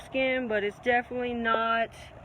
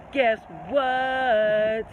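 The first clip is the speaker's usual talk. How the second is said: teasing reveal; 5 dB louder than usual. Real, not acted. Repeated with drawn-out relish.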